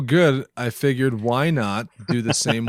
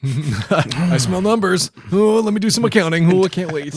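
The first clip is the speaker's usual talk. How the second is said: eager auditor; 5 semitones higher than usual. Acted. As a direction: play a greedy auditor, a little high. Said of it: "auditors come to it like".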